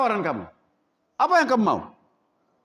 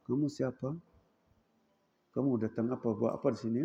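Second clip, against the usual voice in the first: much lower, softer, meek questioning; timid coward; 8 semitones lower, 8 dB quieter, faster than usual. Acted. Cowardly man questioning strangers, low and timid. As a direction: ask like a scared man hiding it. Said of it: "Kalau laki-laki penakut kan".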